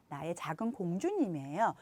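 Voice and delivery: normal talking voice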